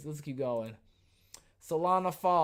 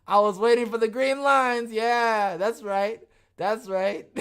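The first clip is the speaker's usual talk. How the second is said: loud, high sarcastic gloating; 6 semitones higher, 9 dB louder than usual. Pretend. Streamer fakes triumph over the green lines, sarcastically. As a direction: lay the sarcasm on thick. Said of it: "now, that's called sarcasm".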